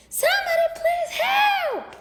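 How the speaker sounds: high, loud panicked scream. A narrator's reading. Sheila scared of the dog, screaming high and loud. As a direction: shriek for help in fright. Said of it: "she screamed".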